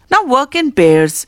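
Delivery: ordinary delivery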